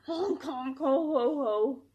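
a loud honking gander laugh. A narrator's reading. Gander mocking the hedgehog, loud honking laugh. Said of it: "the gander laughed".

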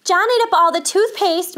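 put-on high, loud child telling on brother; indignant sibling tell-tale; tattling. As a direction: report the crime to mother. Sister tattles to mother.

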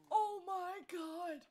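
loud, drawn-out high gush; excited gushing. Real, not acted. Player first admires the baby, loud excited gushing. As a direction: gush loudly, stretching the words.

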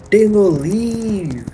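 high, slow, drawn-out joking command; mock banishment. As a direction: stretch it out as a fake eviction. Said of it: "I'm joking".

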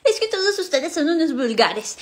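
lightly mimicking the old aunt's words; mocking imitation of aunt. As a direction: parrot her line back, mocking. Girl mocks aunt's words.

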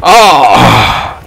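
usual speaking voice

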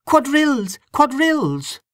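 high-pitched excited call to the room; excited cry. A narrator's reading. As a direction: cry it out high, summoning everyone to dance. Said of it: "excitedly clapping her hands and crying".

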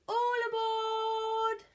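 high, long-held pirate call; loud boarding cry. A narrator's reading. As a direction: holler it, stretching the last word. Pirate captain calls out.

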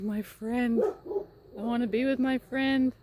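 lightly voiced whiny horse, slow; voicing the horse. Owner voicing her horse crying for his friend, mild pleading whine. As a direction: give the lonely horse a pleading little voice. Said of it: "It's like".

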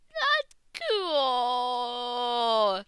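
hammed-up whimpering sob; fake-cry it, stretched and wailing; mock crying. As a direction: gamer mock-sobbing over lost loot. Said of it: "Now I'm crying".

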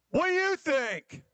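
high, slow put-upon fisherman voice; frustrated fisherman. Retold or imitated. Fisherman answering the stranger, high and fed up. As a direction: drag it out, fed up.

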